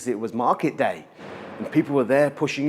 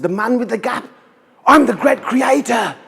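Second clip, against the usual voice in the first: booming revelation; 10 semitones higher, 7 dB louder than usual. Acted. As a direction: thunder out who you really are. Creator reveals himself angrily.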